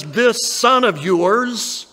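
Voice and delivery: This son of yours drawn out slowly, each word weighted; slow, pointed, distancing; scornful stress. Acted. Preacher showing the older son's cold words about his brother, slow and pointed. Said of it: "He won't even call him "my brother".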